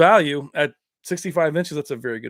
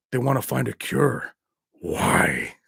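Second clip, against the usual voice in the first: low, menacing big-guy voice, dropping deeper; movie villain; 6 semitones lower, 5 dB quieter, slower than usual. Acted. Quoting the Cyborg villain for viewers, deep villain voice. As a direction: sink down low on the last word. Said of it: "the villain, he's like".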